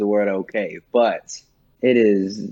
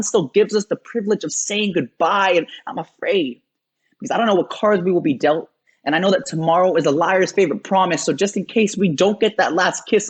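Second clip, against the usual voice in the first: rhythmic spoken-word torrent climbing much higher in pitch; impassioned slam poetry; 8 semitones higher than usual. Acted. Poet writing to a fiance in case of loss, building impassioned slam.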